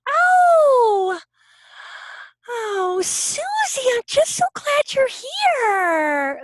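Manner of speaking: high, slow, syrupy fake voice; fake sweet teacher. Teacher greeting a little kid, high fake sweetness. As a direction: gush like an insincere teacher greeting a child. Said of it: "when they're like".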